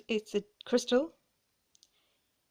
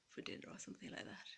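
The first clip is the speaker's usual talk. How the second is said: fast whisper; 19 dB quieter, much faster than usual. Pretend. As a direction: rush it out as a breathy whisper. Dreamy fantasy trailing off.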